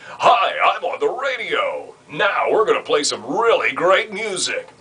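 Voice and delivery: high, slow, over-polished DJ voice; fake radio voice. Shown as a demonstration. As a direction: put on a cheesy announcer voice. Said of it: "You don't wanna put on that radio voice like".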